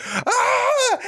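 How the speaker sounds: high drawn-out mock scream; a comic falling yell, held long and high; imitated scream. Teller imitating a scared man flying out of the truck, mock scream.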